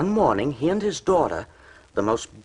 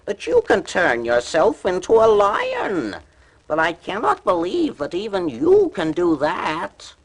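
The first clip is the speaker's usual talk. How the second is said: falsely brave cat; 7 semitones higher, 4 dB louder than usual. A narrator's reading. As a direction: act braver than you feel. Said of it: "looking far braver than he felt".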